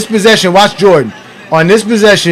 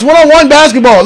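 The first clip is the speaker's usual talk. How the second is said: excited mocking; 6 semitones higher, 4 dB louder than usual. Real, not acted. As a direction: crow over the miss. Mocking Jordan while laughing.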